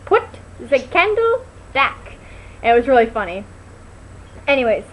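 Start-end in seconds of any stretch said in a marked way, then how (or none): 0.1-2.1 higher, slow movie quote; quoting the line. Retold or imitated. Two friends at intermission quoting the movie line slowly. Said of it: "looked at each other and went".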